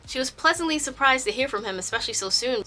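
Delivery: usual speaking voice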